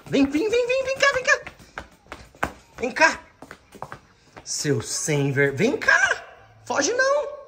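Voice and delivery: high coaxing call to the dog. Real, not acted.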